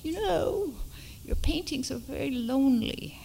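put-on English accent for a quoted critique; Andrew. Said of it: "I have to do my fake English accent".

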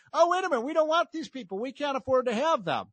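high, mocking whine of sudden reluctance; sarcastic high-pitched parody of the voters; mocking hypocrites. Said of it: "are saying".

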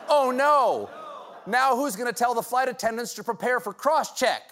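hammed-up panic; mock panic. Mock panic over pilot shortage.

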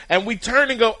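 ordinary delivery

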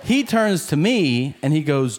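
ordinary delivery

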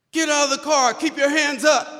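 high, forceful shouted command; police shout. Retold or imitated. Officers ordering the driver out of the car, shouting. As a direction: bark orders at gunpoint.

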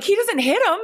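high-pitched retold objection; retold protest. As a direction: protest in disbelief, voice jumping way up.

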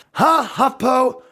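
high, drawn-out sneer at HuffPo; mocking jab. Comic taunting the media outlet with a slow high sneer.

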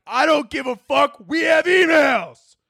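high manic yell, stretching the last words; manic shouting. Acted. Adderall overruling the hungry emotions, manic shouting.

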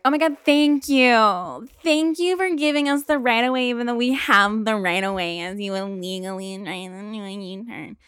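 high, loud mock gratitude fading into garbled ranting; sarcastic yelling. Pedestrian to driver who shooed her away, sarcastic yelling. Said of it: "I yelled at a cart".

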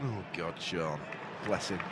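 low, soft pity. Real, not acted. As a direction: sigh it out with pity. Pitying sigh.

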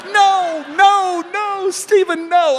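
high, loud horrified no; shocked cry. Retold or imitated. Mother realizing the meaning, loud shocked protest. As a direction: mom finally gets the joke, crying out.

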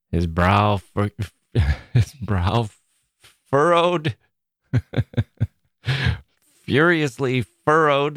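laughing through the words; amused, chuckling. Real, not acted. Host recalling roommate's furrowed brow, amused, laughing. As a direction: chuckle and stumble over the words.

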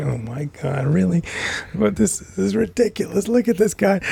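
mock-aghast, like he can't believe his eyes; incredulous inner voice. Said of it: "and then I'm thinking".

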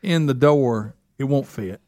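normal talking voice

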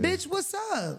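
slow, high taunt; taunting challenge. Pretend. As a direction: dare them to come fight. Daring a fighter, drawn out.

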